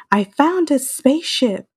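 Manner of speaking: high, excited half-yell; mock yell. Podcast host retelling Woody calling to Buzz, mock yell. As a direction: call it out urgently, as a retold movie line. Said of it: "He yells".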